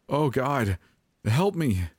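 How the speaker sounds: high, desperate plea; weeping. A narrator's reading. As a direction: cry for help in tearful terror. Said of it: "I wept".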